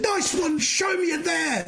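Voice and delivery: high-pitched shout of approval, re-enacted; touchline cheering. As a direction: cheer on your son's tackle from the sideline. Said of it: "like that".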